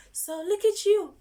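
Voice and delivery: high loud proud cheer; excited praise. Real, not acted. Teacher proudly praising the kids. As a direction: beam with pride at them.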